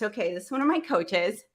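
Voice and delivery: ordinary delivery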